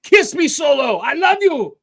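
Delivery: high, loud mock wrestler yelling his love; mock lovesick yell. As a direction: yell it like a lovestruck brute mid-match.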